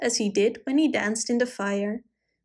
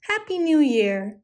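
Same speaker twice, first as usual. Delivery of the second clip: called out; 4 semitones higher than usual. A narrator's reading. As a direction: cheer it across the snow. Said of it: "they called to each other".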